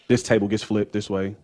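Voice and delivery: ordinary delivery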